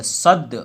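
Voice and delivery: सद with the द bounced, loud; echoing dal; qalqala on dal. Shown as a demonstration. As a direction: knock the final द of सद. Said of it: "दाल पे कलकला करना".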